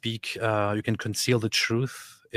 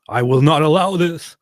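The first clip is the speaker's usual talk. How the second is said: loud, raised, playful proclamation; mock-indignant decree; 6 semitones higher, 10 dB louder than usual. Husband objecting to giving Yoshi away, mock-outraged. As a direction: put your foot down with hammed-up force.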